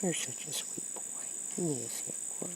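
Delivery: softly spoken praise for the cat; soft pet talk. Real, not acted. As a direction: murmur fondly to a pet.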